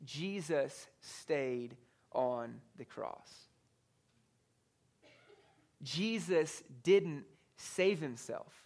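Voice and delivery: slow, weighty delivery with long pauses; grave emphasis. Real, not acted. Preacher reveals the shock of Friday, slow and grave. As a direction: let each word land separately.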